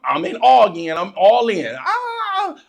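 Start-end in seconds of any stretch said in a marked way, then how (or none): 1.8-2.6 put-on high-pitched kid's cry of delight; excited squeal. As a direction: squeal in play-acted excitement. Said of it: "I'm excited".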